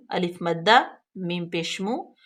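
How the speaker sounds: ordinary talk, nothing marked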